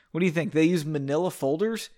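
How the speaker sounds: ordinary delivery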